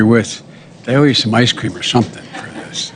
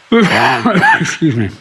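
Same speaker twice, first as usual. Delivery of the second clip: laughing; 6 semitones higher, much slower than usual. Real, not acted. Laughs while apologizing.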